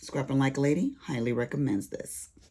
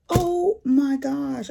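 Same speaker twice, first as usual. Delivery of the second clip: exasperated; 10 semitones higher, 7 dB louder, much slower than usual. Retold or imitated. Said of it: "I was just like".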